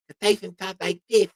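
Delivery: high, lisping boxer impression; thick lisp in a high voice; Mike Tyson lisp. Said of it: "you know, like Tyson".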